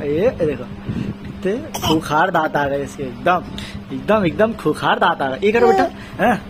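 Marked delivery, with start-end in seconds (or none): none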